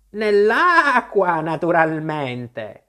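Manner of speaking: slow, drawn out, starting high and loud, falling; impatient sheep. Acted. The sheep answers Alice's question, slow impatient explanation. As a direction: state the obvious with long stretched vowels.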